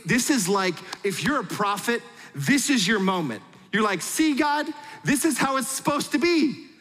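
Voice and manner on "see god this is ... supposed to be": high-pitched, exultant boasting to heaven; elated triumph. Retold or imitated. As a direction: act out a prophet thrilled with his big moment. Acts out prophet's triumphant moment.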